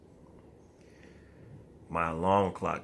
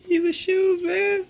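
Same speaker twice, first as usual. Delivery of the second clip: squeaky laughing disbelief, much higher and louder; high laughing outcry at the shoes; incredulous laughing; 8 dB louder, slower than usual. Real, not acted. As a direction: crack up in disbelief.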